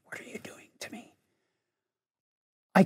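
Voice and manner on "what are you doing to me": quick, hushed whisper; whispered thought. Retold or imitated. Teacher silently questioning the prompting he just got, urgent whisper. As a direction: whisper it fast, as a private aside. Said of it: "At which point I'm thinking".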